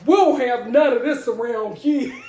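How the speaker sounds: loud, hammed-up New York neighbor; New York accent. Imagined neighbors object to the commotion, mock New York accent. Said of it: "Oh no, it's New York".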